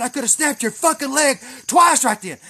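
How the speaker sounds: loud, high, furious accusation; angry yelling. Retold or imitated. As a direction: re-enact chewing out an opponent. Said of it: "I fucking yelled".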